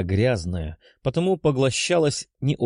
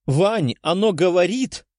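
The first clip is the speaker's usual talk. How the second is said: excited exclaim; 8 semitones higher than usual. Acted. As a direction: burst out in disbelief. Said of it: "воскликнула девушка".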